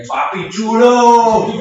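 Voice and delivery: drawn-out high yell from the back of the room; heckler yelling. Retold or imitated. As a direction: holler it slowly across the room like a heckler. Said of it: "Like, yelling at me".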